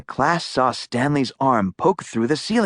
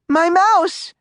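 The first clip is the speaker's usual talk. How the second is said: put-on high, louder girl's cry; 4 dB louder than usual. A narrator's reading. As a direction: cry out in alarm for the lost pet. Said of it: "cried Sophie".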